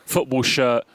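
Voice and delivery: ordinary delivery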